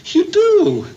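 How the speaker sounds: high, loud delighted disbelief; excited. Acted. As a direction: burst out with happy surprise. Overjoyed she says it back.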